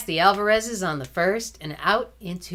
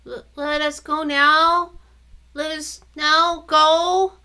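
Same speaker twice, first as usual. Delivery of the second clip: put-on slow, stumbling boy mixing up the line; fumbling the order of the words; little brother muddling his pageant line, slowly; halting recital again; 9 semitones higher, 7 dB louder, slower than usual. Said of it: "frowned harder".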